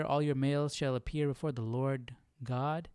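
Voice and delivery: ordinary delivery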